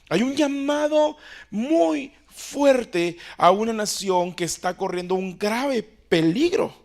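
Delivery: high, loud, urgent pulpit voice; impassioned sermon climax; fiery preaching. Acted. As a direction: preach it at full force, pressing each muy. Preaching peak on urgent call.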